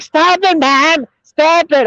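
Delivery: high-pitched whiny imitation of her; mock giggly daughter. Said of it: "she started giggling and shit".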